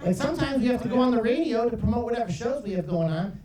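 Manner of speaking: usual speaking voice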